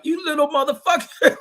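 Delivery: high, laughing mock scold. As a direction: scold the dog through laughter. Scolding dog in retold scene.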